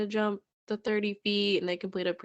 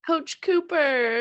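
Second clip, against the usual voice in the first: delighted drawn-out name; 9 semitones higher, 7 dB louder, much slower than usual. Real, not acted. Cute name repeated.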